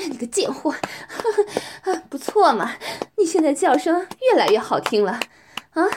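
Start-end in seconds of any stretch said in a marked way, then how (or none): 0.8-2.1 breathy laugh. Acted. Teasing partner in dominant roleplay.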